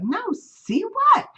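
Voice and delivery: high, slow, baffled "see what?"; genuinely lost, asking slowly; puzzled. Retold or imitated. Her puzzled reply.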